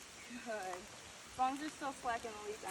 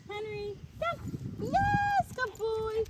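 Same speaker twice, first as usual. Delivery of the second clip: high, bright and loud, calling the dog in; excited high-pitched dog praise; 10 semitones higher, 8 dB louder, much slower than usual. Shown as a demonstration. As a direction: throw the dog a party with your voice. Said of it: "we use higher pitched tones of voice to be exciting".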